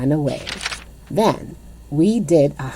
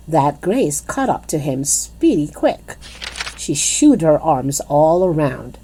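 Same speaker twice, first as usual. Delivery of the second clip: plain reading voice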